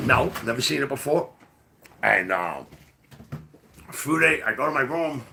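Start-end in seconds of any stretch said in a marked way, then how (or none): none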